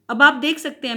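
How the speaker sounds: normal talking voice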